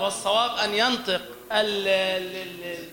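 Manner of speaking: usual speaking voice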